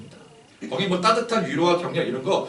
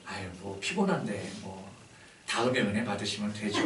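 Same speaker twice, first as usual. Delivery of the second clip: mild indulgent coaxing; 6 semitones lower, 9 dB quieter than usual. Retold or imitated. Lenient pastor voicing indulgence.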